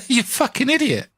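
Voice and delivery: mock the listener through laughter; teasing laugh at the listener; laughing insult. Real, not acted. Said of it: "I'm laughing at you".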